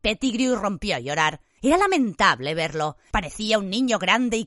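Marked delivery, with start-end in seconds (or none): none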